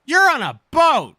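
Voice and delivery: high, loud exclamation; excited shout. Pretend. Player hollering at a character on a boat, playful high shout. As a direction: belt it out with mock amazement.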